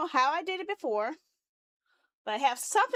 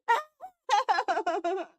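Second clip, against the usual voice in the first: high, stuttering mock-sob laugh; half-laughing wail; hammed-up despairing laugh; 6 semitones higher, 4 dB louder than usual. Real, not acted. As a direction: break into high, pulsing helpless laughter. Despairing over broken code.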